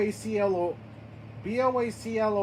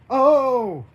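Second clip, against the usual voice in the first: loud startled "oh"; startled cry; 8 dB louder than usual. Acted. Character feels her hands tingle, startled cry. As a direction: cry out suddenly.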